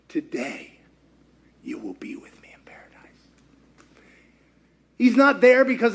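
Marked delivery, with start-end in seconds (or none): 0.1-2.9 hushed, fading to a whisper; tender whispered promise. Acted. Jesus speaks to the thief.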